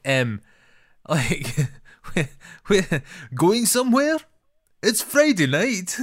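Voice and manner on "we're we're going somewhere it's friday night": much higher, put-on voice for a clueless guy; mock Scottish film-character voice, pitched way up. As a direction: squeak it out like a dim bloke, slowing at the end. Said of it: "the guy at the beginning".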